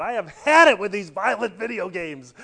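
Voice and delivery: loud, high exasperated yell; cartoon mom yelling. Cartoon mom with bat at gamer son, yelling. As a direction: blow up like a fed-up mom. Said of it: "and she said".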